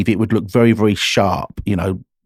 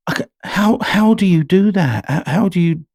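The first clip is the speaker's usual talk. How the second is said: imitating a puzzled fan, pitched much higher; mock amazed fan; 6 semitones higher than usual. Fans ask the singer how they switch accents, mock amazed. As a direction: put on a high, wondering fan's voice.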